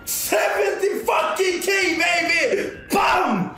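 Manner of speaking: slow triumphant shout; shrieking jackpot winner. Real, not acted. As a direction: drag it out, yelling in triumph. Big slot win celebration.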